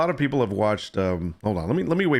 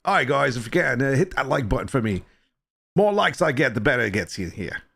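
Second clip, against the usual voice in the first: English accent. Shown as a demonstration. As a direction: plead for likes in a bad English accent. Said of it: "if I do it in an English accent".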